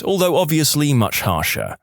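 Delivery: plain reading voice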